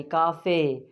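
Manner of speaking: काफ़े with a held final "ay"; Spanish pronunciation. Shown as a demonstration. Teacher repeats Spanish word, काफ़े ending "ay". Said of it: "काफ़े में ए की आवाज़".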